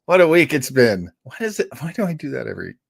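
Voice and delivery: usual speaking voice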